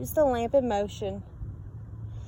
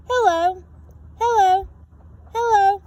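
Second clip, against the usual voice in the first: calling out; 7 semitones higher, 5 dB louder than usual. A narrator's reading. Lighthouse calling refrain.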